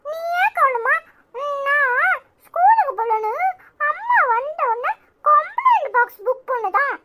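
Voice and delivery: put-on high childish complaint; child tattling. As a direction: kid telling on his brother. Child complains about brother.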